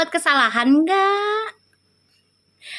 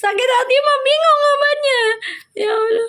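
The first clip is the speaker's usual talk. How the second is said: high squealing laugh through the words; 10 semitones higher than usual. Real, not acted. Host laughing at her own joke to viewers, high squeal.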